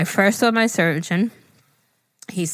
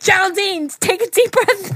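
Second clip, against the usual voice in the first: urgent nurse; 12 semitones higher, 4 dB louder than usual. Retold or imitated. Voicing nurse again.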